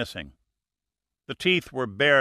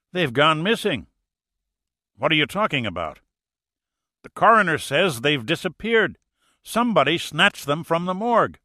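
higher, louder panicked report; alarmed; 5 semitones higher, 5 dB louder than usual. A narrator's reading. Frightened deputy telling the sheriff, loud and alarmed. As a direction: blurt it out, still rattled.

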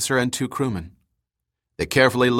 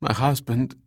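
trailing off; 2 semitones higher than usual. A narrator's reading. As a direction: voice fading away into grief. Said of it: "Her voice trailed off".

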